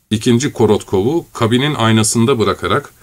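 usual speaking voice

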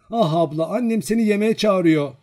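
put-on high, loud child's summons; excited high calling voice. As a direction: pipe up loudly with a message for big sister. Little sibling calls her to supper.

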